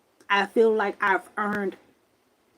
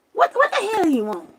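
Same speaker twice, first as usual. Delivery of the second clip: angry; 10 semitones higher than usual. Retold or imitated. Confronting the ghost angrily.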